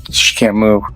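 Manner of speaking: ordinary talk, nothing marked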